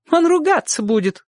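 very high female character; woman's voice. A narrator's reading. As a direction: object as the neighbour woman, pitched high. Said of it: "ответила женщина". (высокий женский голос)

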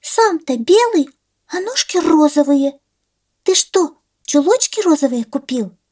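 very high squeaky chick voice; peeping baby chick. Acted. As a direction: peep like a curious tiny chick. Chick questions the gosling.

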